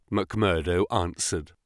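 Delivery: ordinary delivery, nothing marked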